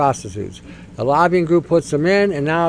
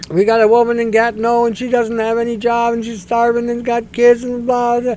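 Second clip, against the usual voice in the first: mock pleading MP; 6 semitones higher than usual. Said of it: "he rails the same thing".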